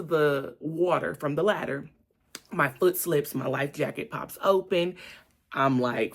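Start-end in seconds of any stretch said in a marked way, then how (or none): none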